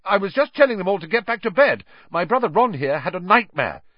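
high, loud, hurried self-defence; indignant. A narrator's reading. Percy explains himself to the professor, indignantly. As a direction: rattle off a puffed-up excuse. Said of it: "puffing himself up indignantly".

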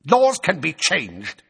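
high, loud, slow savage retort. A narrator's reading. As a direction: spit it out. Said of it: "said Fudge savagely".

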